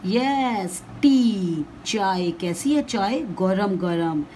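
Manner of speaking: usual speaking voice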